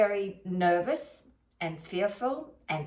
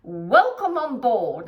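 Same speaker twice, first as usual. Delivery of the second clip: bright, louder PA voice; cabin crew announcement; 2 semitones higher, 7 dB louder than usual. Retold or imitated. Flight attendant greets passengers on board, PA announcement. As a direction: welcome passengers over the PA, louder. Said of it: "make all the P As".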